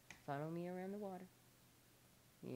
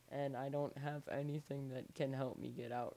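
ordinary talk

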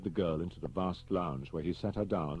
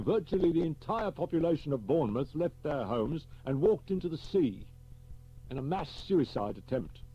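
higher, louder brisk senior officer; blunt superintendent; 10 semitones higher, 4 dB louder than usual. Acted. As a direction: clip through it like a blunt chief. Superintendent reports grave news.

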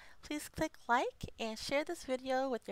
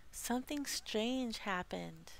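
ordinary talk

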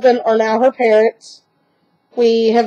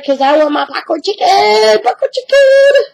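whiny dog voice; 9 semitones higher than usual. Pretend. Owner voicing her dog begging for popcorn chicken, high whine. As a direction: whine it like a spoiled pup begging.

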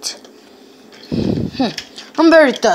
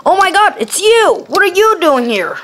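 surprised; 8 semitones higher than usual. Acted. Surprised at finding a hider.

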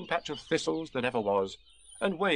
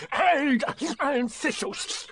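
high, spluttering creature voice; Tigger; much slower than usual. A narrator's reading. Tiger running in circles, high and spluttering. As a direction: splutter it out with a sore tongue. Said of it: "said Tigger".

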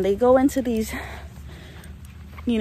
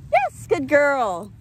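praising the dog; 2 semitones higher, much slower than usual. Real, not acted. Praising dog after recall.

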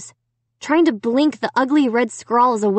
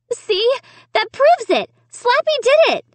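high, loud cry of vindication; cried out; 4 dB louder than usual. A narrator's reading. Girl pointing parents to Slappy, crying out. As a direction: cry out the proof, pitched way up. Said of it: "I cried".

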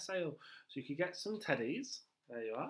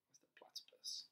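unvoiced secretive whisper; 14 dB quieter than usual. Teacher whispering a question to the children. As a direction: lean in and whisper it. Aside in storytelling.

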